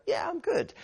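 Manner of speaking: lifted, upbeat reply; matching energy. Retold or imitated. Other person perking up to match the energy. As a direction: sound perked up by the energy. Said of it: "they change to meet your demands".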